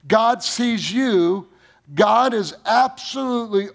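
high, loud, very slow proclamation; full force preaching. Acted. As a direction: proclaim each word to the back pew. Preacher's climactic declaration.

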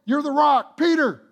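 Jesus urging Peter, loud and high. Retold or imitated. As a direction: call it out to Peter with force. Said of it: "Trying to let him know".

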